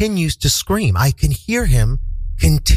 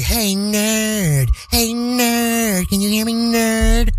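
high, drawn-out mock heckle; yelling taunter; 8 semitones higher, much slower than usual. Stranger heckling a man in glasses, high mock yelling. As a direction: stretch the taunt out in a high imitation yell. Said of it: "he's just yelling anything".

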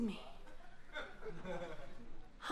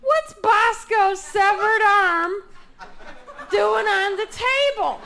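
loud, slow, horrified mock mother; hammed-up shocked mom; alarmed mother; 6 semitones higher, 5 dB louder, slower than usual. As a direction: drag out the horror of the find. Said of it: "she goes".

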